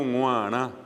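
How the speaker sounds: "guará" nasalised to "nguaná"; "guará" as nasal "nguaná". Shown as a demonstration. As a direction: hum into the g of "guará" and nasalise it through. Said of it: "allí está la G nasal".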